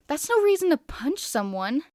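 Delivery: loud, high scolding rebuke; loud indignant scolding. Acted. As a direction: snap at him for throwing a punch. Narrator voicing a scolding friend.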